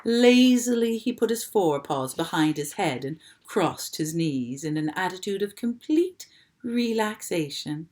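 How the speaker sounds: plain reading voice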